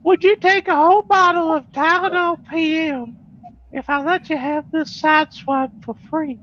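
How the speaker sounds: put-on high-pitched feeble old man voice; Herbert from Family Guy. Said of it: "pretend to be, like, Herbert from Family Guy".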